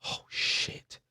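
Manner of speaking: whispered dread, barely voiced; hushed awe. Fan reacting to finally meeting the villain, hushed. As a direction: let it out as an awed whisper.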